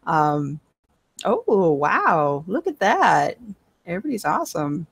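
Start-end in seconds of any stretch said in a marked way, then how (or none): 1.2-4.9 louder, swooping amazement; impressed wow. Real, not acted. Game master seeing everyone's perception rolls, impressed wow.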